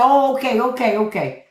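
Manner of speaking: high, loud mock offence, then settling; a lady bristling, about to turn up; offended Jersey lady. Coworker from Jersey bristling at the question, mock huffy and high. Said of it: "She was about to turn up on me".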